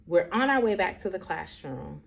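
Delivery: normal talking voice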